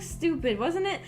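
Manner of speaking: ordinary talk, nothing marked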